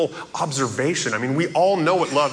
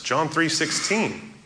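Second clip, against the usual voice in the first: ordinary talk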